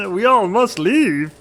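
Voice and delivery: high, loud, slow puppet voice; hammed-up comic croak, nearly breaking into laughter; Kermit. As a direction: declare it in a silly high puppet voice. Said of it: "It's like a campaign of Kermit".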